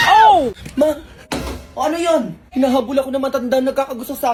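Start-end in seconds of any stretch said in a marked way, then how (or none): 0.1-0.9 loud, high startled cry for mom; startled yell. Acted. Son fleeing a pursuing old woman calls out in alarm.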